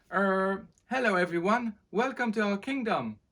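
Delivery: put-on young prince voice. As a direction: greet the crowd a little unsure. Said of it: "said Henry to the excited crowd".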